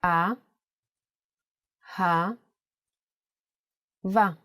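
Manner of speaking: "A, ha, va" with a long pause after each syllable. Shown as a demonstration. Teacher writing the word in print, sounding "A, ha, va" syllable by syllable. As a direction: say "A, ha, va" as separate, spaced-out syllables. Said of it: "Now let's do it in print".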